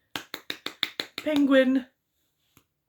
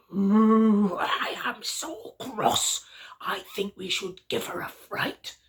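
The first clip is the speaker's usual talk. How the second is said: Daddy Bear. Acted. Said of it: "And Daddy Bear said".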